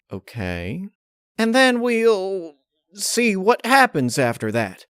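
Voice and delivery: high, slow, evasive drawl; nervous stalling. A narrator's reading. Applejack evades Twilight's question, nervous stalling. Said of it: "Her stammering was getting annoying".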